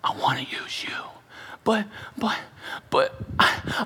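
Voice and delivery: unvoiced, hushed Moses pleading; whispered plea. Moses whispering back to God, hushed. Preacher voicing Moses' reluctance.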